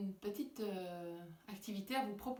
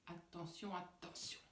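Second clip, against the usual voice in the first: hushed warning; 3 semitones lower, 10 dB quieter than usual. Acted. Magician hushing before the trick.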